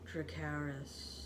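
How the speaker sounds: flat, slow and very quiet; deadpan. Retold or imitated. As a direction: say it with zero emotion. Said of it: "a very deadpan Dracarys".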